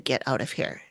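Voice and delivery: low, quiet imitation of a man's voice; very deep voice. Quoting the voice that came to the investigator, very deep man's voice. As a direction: sink the pitch and hush it, imitating the ghost. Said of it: "very deep".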